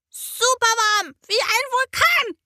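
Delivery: put-on high excited child's call. A narrator's reading. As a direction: shout it like a hot little volcano. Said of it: "ruft sie".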